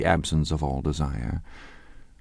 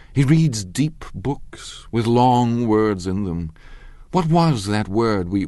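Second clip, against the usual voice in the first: mocking; 5 semitones higher, 4 dB louder, slower than usual. Daisy mocking Tom.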